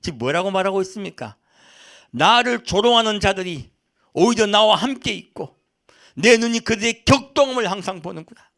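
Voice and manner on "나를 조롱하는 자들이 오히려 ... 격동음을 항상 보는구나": loud, high indignant retelling of scripture; Job's angry outcry. Acted. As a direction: voice Job's outrage, stretching the opening words. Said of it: "욥이 얼마나 화가 나는지 몰라요".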